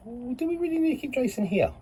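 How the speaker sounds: high put-on pondering voice; imitated wondering colleagues. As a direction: wonder aloud in a high, sustained mock voice. Voicing colleagues wondering about him.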